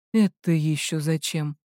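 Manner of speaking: low, guarded male-character question; slightly tense. A narrator's reading. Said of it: "напрягся он". (настороженно, низким голосом)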